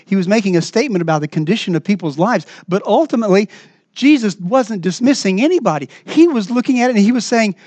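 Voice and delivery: normal talking voice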